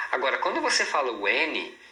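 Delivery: ordinary talk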